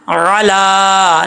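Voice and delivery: علا with a very long drawn a; recitation demo. Shown as a demonstration. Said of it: "کیسے پڑھنا ہے؟".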